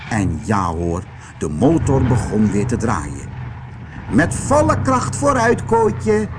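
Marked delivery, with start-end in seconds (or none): 4.1-6.3 very high-pitched aunt voice; commanding aunt. A narrator's reading. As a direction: send the boy off in a high call. Aunt giving command in story. (hoge, bevelende tantestem)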